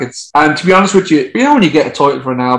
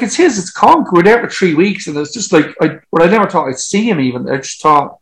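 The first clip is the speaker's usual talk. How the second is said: ordinary delivery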